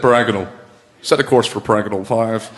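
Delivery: a hint of a low English sci-fi voice; English accent. Shown as a demonstration. Comic mocking British sci-fi, low English accent. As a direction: set a course for paragonal, English way. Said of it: "English accent".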